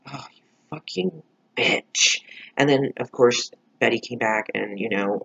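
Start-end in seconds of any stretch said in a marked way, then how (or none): 0.1-2.2 slow, scornful put-on voice; disgusted aside. Viewer reacting to Polly snitching, slow scornful voice. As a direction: drag it out with contempt. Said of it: "I was just like".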